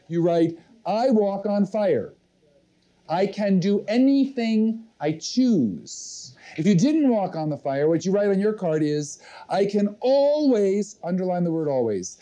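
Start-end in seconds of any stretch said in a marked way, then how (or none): 0.9-6.4 slow, deliberate affirmation, pitched higher; measured words for copying onto a card; slow dictation. Shown as a demonstration. Dictating affirmation for audience to write. 9.5-11.0 ALWAYS drawn out slowly, pitched higher; slow dictation leaning on "always"; emphatic dictation. Shown as a demonstration. Dictating, word to be underlined.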